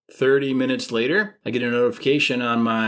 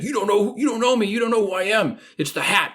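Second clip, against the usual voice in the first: getting angrier; 11 semitones higher than usual. Retold or imitated. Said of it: "he got angrier and angrier".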